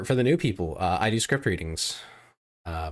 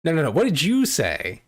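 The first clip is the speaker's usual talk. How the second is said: demanding; 7 semitones higher, 6 dB louder than usual. Real, not acted. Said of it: "Oh, what did I say?".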